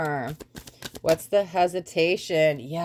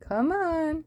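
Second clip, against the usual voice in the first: lilting high call to a cat; sweet high-pitched pet voice; high coaxing cat call; 11 semitones higher than usual. Real, not acted. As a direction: sing it up high to coax the kitty. Coaxing the cats again, higher.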